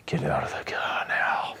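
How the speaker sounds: urgent whisper from off camera; a director's whispered order mid-take. Retold or imitated. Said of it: "that's him talking".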